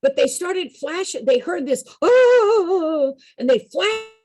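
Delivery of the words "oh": high, loud wailing cry; ghostly wail. Retold or imitated. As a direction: let out a ghostly moan. The ghost heard crying out.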